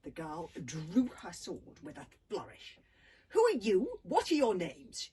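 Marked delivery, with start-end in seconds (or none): 3.3-5.1 fierce demand. A narrator's reading. Little girl confronting the captured boys, fierce and loud. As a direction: bark the questions at the intruders. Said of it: "she demanded fiercely".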